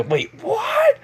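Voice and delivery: very high-pitched, incredulous yelp; stunned, pitch shooting way up; shocked reaction. Acted. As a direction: squeal it in mock disbelief. Friends react to surprise sister news.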